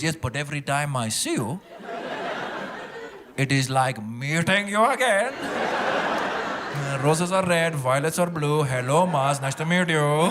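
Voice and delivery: slow, mock-poetic Indian father-in-law; poetic Indian accent. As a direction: recite it like a proud Indian uncle. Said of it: "tries to cover up by acting poetic".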